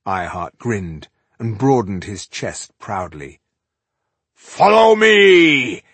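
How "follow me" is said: high, drawn-out rallying shout; bawled battle command. A narrator's reading. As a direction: bawl it slowly across the battlefield. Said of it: "he bawled".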